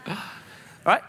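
normal talking voice

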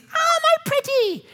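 high cackling witch line. Retold or imitated. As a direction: sneer it like the Wicked Witch. Quoting the witch.

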